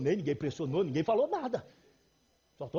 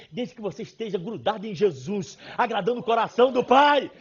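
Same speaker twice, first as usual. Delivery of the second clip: usual speaking voice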